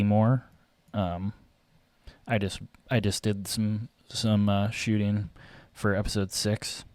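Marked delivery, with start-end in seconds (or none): none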